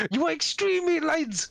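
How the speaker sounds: high, marvelling exclamation; excitable papa in a lifted voice; amazed father. Acted. Father picks guest up by the sides, high and amazed.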